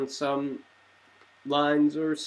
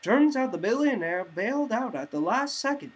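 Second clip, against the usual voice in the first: higher, louder bright newscast; news anchor read; 5 semitones higher, 3 dB louder than usual. Acted. TV anchors reporting on Bruce's crash, newscaster style. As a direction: deliver it like an evening news anchor.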